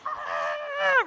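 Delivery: high mock scream, a short burst of horror; retold inner scream. Pastor acts out a silent inner scream at cicada killers, high mock scream. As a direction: let out a hammed-up high scream of panic. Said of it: "In my mind, I'm screaming".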